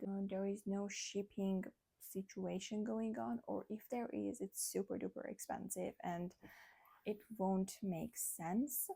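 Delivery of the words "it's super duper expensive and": ordinary talk, nothing marked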